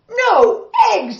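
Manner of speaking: high, loud dismayed cry; cried out. Acted. Farmer learning hens stopped laying, loud cry. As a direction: wail it in disbelief. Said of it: "cried Farmer Brown".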